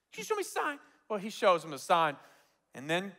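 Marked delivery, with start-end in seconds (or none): 0.1-0.8 tiny high, soft voice; timid plea. Retold or imitated. Mimicking people who keep asking for confirmation, timid plea. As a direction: squeak out a meek request.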